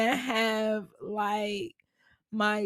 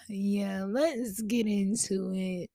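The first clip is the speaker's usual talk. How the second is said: ordinary delivery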